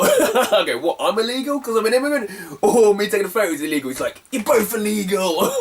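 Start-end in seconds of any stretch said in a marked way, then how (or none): 0.8-3.9 high, laughing comeback; amused mock disbelief. Teasing the seller mid-argument, laughing. 4.3-5.5 high, drawn-out accusation; hammed-up outraged stallholder; mock angry seller. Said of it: "he was getting angry".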